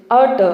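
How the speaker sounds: teacher models అర్ట్; model pronunciation. Shown as a demonstration. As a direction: sound out అర్ట్ for students to repeat, r silent. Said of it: "నాతో పాటు పలకండి".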